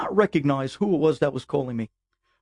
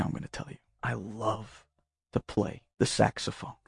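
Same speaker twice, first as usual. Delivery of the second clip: soft secretive whisper, leaning in; hushed confession from a teen; confiding whisper; 6 semitones lower, 11 dB quieter than usual. Retold or imitated. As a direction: let him in on a secret. Kid leans in to confide.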